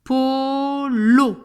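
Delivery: POOO-LO, loud and drawn out; syllable by syllable. Shown as a demonstration. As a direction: split "Polo" in two, holding the first vowel. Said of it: "je découpe toujours mon mot en son".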